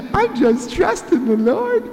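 high, sing-song churchy voice; a syrupy super-spiritual believer; mock pious sweetness. Mocks flowery pious response.

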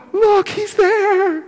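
a sharp gasp, then high and startled; astonished gasp. Retold or imitated. Disciple gasping at the risen Jesus, astonished. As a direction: gasp as if seeing him appear.

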